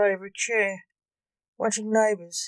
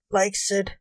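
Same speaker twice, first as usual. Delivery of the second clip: ordinary delivery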